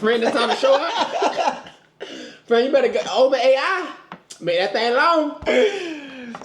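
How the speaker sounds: high, slow, laughing tease; excited teasing. Real, not acted. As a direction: drag it out, giggly and high.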